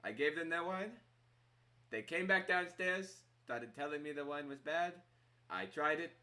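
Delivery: offended tavern keeper in character; indignant innkeeper. Acted. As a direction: lay out your grievance against rude guests. Game master as offended innkeeper.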